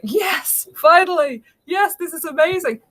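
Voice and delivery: high-pitched, thrilled relief; excited. Retold or imitated. Guest recalling getting a friend's old iPhone to try, excited. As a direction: re-enact being thrilled, pitch jumping up. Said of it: "I was so excited".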